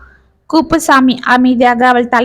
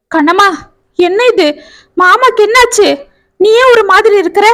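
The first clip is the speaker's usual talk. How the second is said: higher, anxious, quicker voice; worried young man; 7 semitones higher than usual. A narrator's reading. Cousin finds her at hospital, higher anxious voice. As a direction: ask her urgently what happened.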